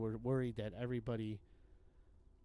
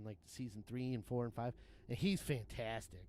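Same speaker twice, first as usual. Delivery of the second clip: usual speaking voice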